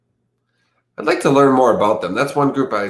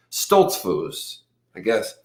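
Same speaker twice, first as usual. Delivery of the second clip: ordinary delivery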